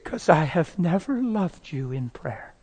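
hushed, drawn-out admission; retold tearful confession. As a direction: confess it slowly and softly.

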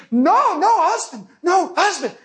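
high-pitched mock refusal; protesting woman. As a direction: imitate a woman urgently fending off a hug. Woman refusing his hug in alarm.

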